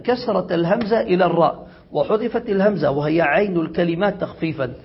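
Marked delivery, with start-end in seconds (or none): none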